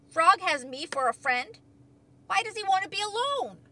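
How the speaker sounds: loud, rising bewilderment; puzzled protest. A narrator's reading. Toad reads that his friend wants solitude, puzzled protest.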